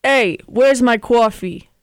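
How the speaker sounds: put-on loud, drawn-out New Jersey accent. Player giving a New Jersey clue for friends to guess, hammed-up accent. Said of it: "No, uh, New Jersey".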